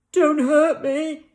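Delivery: high, slow, scared plea; hammed-up frightened whimper; whimpering lion. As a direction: beg not to be hurt. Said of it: "he whimpered".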